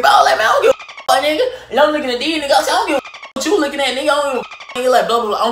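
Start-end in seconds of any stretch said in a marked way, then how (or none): 0.4-1.7 high-pitched acted cussing. Retelling his cussing at staring people, heated. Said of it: "I'm cussing out everybody". 2.7-4.9 mock heated cussing, pitched high. Retelling his cussing at onlookers, mildly heated. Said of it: "I'm cussing out everybody".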